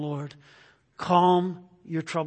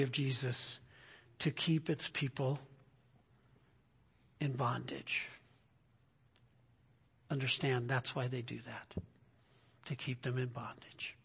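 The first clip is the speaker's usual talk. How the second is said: much softer, low grave warning; lowered preacher's warning; solemn hush; 4 semitones lower, 11 dB quieter than usual. Acted. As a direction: drop the voice to a grave near-murmur. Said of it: "solemn lowered warning".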